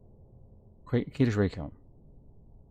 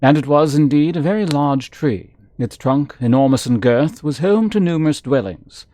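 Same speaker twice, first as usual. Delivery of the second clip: lively read-aloud narrator voice; storybook reading, a touch dramatic; story narration; 4 semitones higher, 4 dB louder than usual. Acted. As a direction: read the book out like telling a tale. Reads game book aloud.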